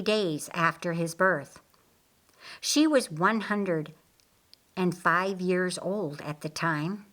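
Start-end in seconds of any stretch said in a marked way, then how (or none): none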